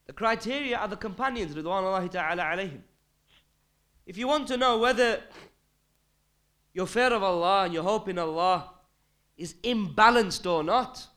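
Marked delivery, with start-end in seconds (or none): 4.1-11.1 raised, higher preaching voice, slowing on imbalanced; forceful preaching. Real, not acted. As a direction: drive the point home to the congregation. Preacher stressing the main point.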